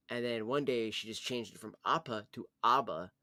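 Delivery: ordinary talk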